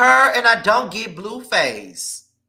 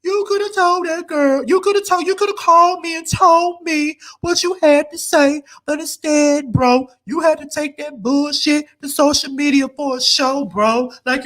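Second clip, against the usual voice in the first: put-on high, whiny nagging girlfriend voice; 5 semitones higher than usual. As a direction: complain at him like a hurt girlfriend, pitched up. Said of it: "that's what she's saying to [name]".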